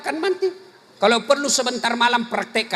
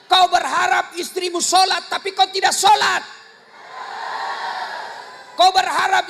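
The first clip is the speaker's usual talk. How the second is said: scolding sermon; 5 semitones higher than usual. Acted. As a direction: hammer every kau at the men. Preacher scolding husbands loudly. (ceramah keras menegur suami, suara tinggi dan lantang)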